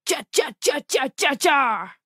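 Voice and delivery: high chanted chop sounds; chopping noises. Acted. Narrator voicing the axes flying at the spaceship, high chopping sounds. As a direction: make the axes whoosh and chop.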